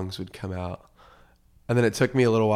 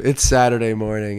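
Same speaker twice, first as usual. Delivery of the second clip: loud joking excuse; 10 dB louder than usual. Real, not acted.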